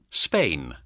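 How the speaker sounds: ordinary talk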